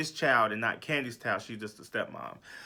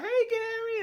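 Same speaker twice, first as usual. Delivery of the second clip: "Hey, Gary" in a squealing, much higher put-on voice; flirty greeting. Portia greeting Gary from Dish Nation, high-pitched squeal. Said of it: "Portia's like".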